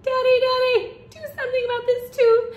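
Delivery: put-on high, loud wail of a child; a loud, high-pitched child's complaint; yelled plea. A narrator's reading. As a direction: yell for daddy in a high kid voice. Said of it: "He took a bite and yelled".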